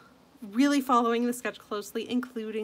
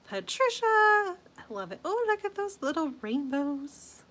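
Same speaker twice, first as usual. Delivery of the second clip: ordinary delivery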